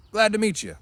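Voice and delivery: loud, high hearty greeting; hearty big man. Acted.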